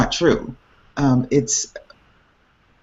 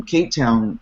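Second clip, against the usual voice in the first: ordinary talk, nothing marked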